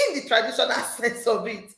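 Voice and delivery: ordinary talk, nothing marked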